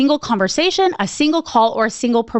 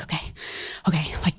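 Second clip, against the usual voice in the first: hushed unvoiced whisper; whispered to herself; 7 semitones lower, 11 dB quieter than usual. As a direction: murmur it under your breath, nervous. Nervous self-talk during the call.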